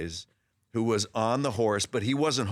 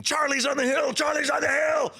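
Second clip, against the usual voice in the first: high frantic yelling; screaming; 4 dB louder than usual. Retold or imitated. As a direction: scream it out as a warning, high and loud. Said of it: "started screaming".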